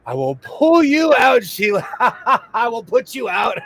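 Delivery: hammed-up angry mom breaking into laughs; mom scolding. Mom yelling at Sheila in the car, mock angry and high. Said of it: "When my mom starts yakking".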